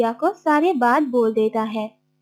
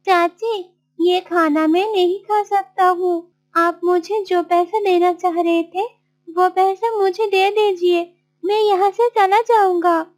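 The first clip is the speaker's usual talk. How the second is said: high-pitched, insistent request; pleading Martin; 6 semitones higher than usual. Acted. Martin refusing spoiled food, asking for money, pleading. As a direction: ask for what is owed, pitched up.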